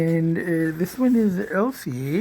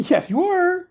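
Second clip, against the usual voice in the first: cooing pet talk; 12 semitones higher, 4 dB louder, slower than usual. Real, not acted. Owner cooing to the cat, high and bright. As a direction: lift it up high like praising a kitten.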